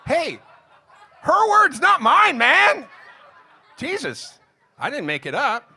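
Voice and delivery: high, shouted self-defense at the crowd; hammed-up high indignant shout; loud mock protest. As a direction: yell back as if wrongly blamed. Mock protest at audience reaction.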